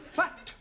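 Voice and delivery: a firm corrected "Fat."; corrected word. Shown as a demonstration. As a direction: hit "Fat." sharply. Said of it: "No, not fat".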